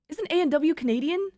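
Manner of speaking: ordinary delivery, nothing marked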